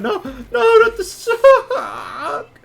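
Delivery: shrill, stretched-out cry of terror; high desperate wail. Acted. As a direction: wail it out, half laughing. DM voices dying guard's cry.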